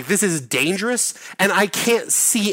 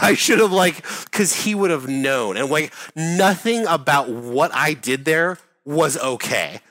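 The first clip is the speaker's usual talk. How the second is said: ordinary talk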